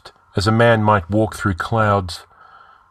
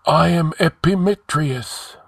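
ghostly sage, pitched high; high, solemn intoning; sepulchral; 8 semitones higher than usual. A narrator's reading. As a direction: announce yourself as a spirit from the tomb. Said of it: "spoke in sepulchral tones".

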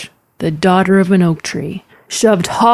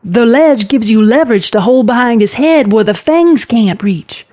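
higher, urgent coaching call; called-out instructions; 5 semitones higher, 4 dB louder than usual. Acted. Aunt coaches niece in the river, calling out. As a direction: call it across the water, emphatically.

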